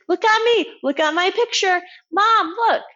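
put-on high, insistent little kid wanting attention; child voice. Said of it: "So the child comes in".

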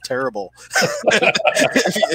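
laughing through words. Real, not acted. Laughing while retelling her words.

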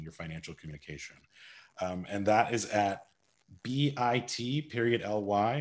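ordinary talk, nothing marked

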